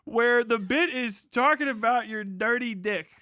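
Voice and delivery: ordinary talk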